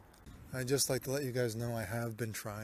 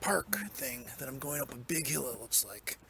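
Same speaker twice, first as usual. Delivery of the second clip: whispering; 2 semitones higher than usual. Acted. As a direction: keep it hushed. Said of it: "I should whisper".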